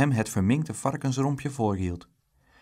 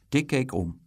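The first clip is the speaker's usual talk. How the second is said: usual speaking voice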